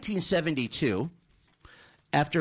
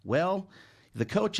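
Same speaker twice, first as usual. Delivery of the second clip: normal talking voice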